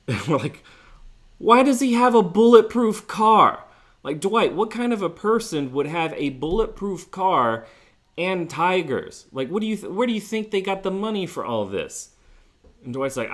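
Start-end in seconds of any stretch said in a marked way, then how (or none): 1.4-12.1 high, loud, disbelieving questions; incredulous retold outburst. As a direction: replay the shock of hearing this, pitched way up. Friends grilling Dwight about bulletproof car.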